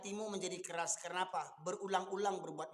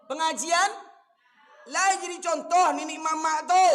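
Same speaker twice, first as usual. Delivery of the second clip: loud and higher, hammering the elders; preaching at full force; 8 semitones higher, 13 dB louder than usual. Real, not acted. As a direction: rail at them from the pulpit. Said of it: "Cuman memang tensi saya naik ketika ngaji ini". (ceramah keras, suara memuncak)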